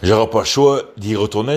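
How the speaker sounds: ordinary talk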